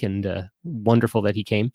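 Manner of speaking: usual speaking voice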